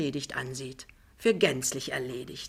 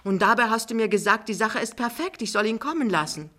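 higher-pitched accusing wife; reproachful Frau Pinneberg; 6 semitones higher, 5 dB louder than usual. Acted. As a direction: pile on the reproach. Frau Pinneberg macht Vorwürfe. (vorwurfsvoll, mit erhobener Stimme)

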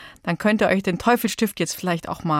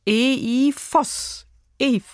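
reading out a name backwards slowly, letter by letter; slow spelling out; 3 semitones higher, slower than usual. Shown as a demonstration. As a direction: sound out each letter slowly. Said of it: "dann ist es".

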